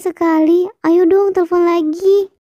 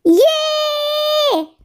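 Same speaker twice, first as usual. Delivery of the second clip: much higher, excited cheer; delighted squeal; 11 semitones higher than usual. Acted. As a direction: squeal with joy. Happy after his date invitation. (teriakan girang)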